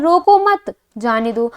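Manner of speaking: रोको मत slowly as one phrase, pause, जाने दो; long comma break after मत. Shown as a demonstration. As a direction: stretch रोको मत, halt, then let जाने दो follow. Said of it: "उसे रोको मत".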